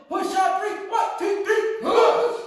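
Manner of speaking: high, loud count-in to a shout; rally the team, building to a yell; team cheer. Real, not acted.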